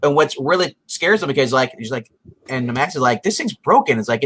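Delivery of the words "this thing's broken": high-pitched imitated complaint; mock frustrated kid. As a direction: lightly act out a baffled kid, pitched up. Retold Max puzzled by phone app.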